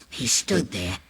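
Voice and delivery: ordinary delivery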